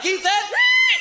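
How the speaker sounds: high shouted mock whale cry; mock whale squeal. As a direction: shriek the whale call back. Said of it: "I'm like".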